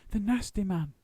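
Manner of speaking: Paddington voice. Acted. Continues Paddington voice.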